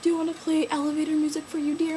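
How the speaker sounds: high mock-sweet paramedic; teasing paramedic. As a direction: coo it like a teasing medic.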